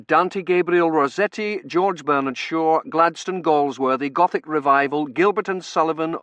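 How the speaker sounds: loud, high-pitched cramming; relentless list. Acted. Dunworthy cramming the narrator with Victoriana, relentless loud list. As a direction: pile up the items without letting go.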